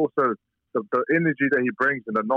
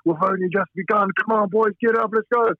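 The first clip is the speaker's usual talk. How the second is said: mock pep-talk cheer; Tyson's hype call; 8 semitones higher than usual. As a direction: fire up the exhausted boys. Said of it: "and he's like".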